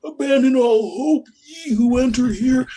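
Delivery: very high, slow, drawn-out pirate cry; squeaky theme-park pirate announcing doom. Retold or imitated. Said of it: "high-pitched kind of a pirate voice".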